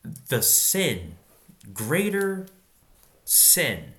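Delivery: "greater" leapt up high and loud, set apart by pauses; stress on greater. Shown as a demonstration. Preacher singling out "greater" for his listeners, stressed high and loud. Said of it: "this is a very interesting phrase".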